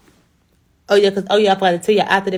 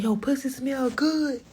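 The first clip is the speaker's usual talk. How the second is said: imitating him; 7 semitones higher, slower than usual. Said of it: "He told me".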